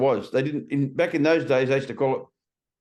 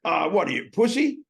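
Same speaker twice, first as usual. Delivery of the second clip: imitated jeer, leaping higher at the end; pushy taunt; 7 semitones higher than usual. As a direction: goad him like a bar bully. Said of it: "they'd sort of push it".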